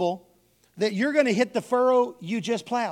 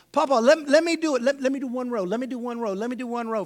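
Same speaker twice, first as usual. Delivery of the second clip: put-on begging child; 3 semitones higher than usual. Kid begging repeatedly.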